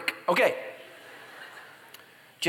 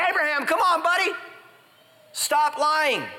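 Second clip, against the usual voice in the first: much higher, louder mock plea; exasperated scolding; 13 semitones higher, 4 dB louder than usual. Pastor voicing a fed-up friend to Abraham, high mock scolding.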